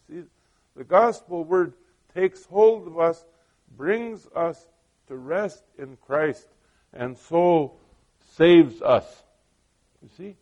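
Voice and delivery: loud, rising preacher's build; preaching. Acted. Preacher telling the congregation how the gospel saves, building loud. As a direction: drive it home to the congregation.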